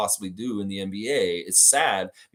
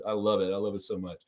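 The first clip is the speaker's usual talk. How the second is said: usual speaking voice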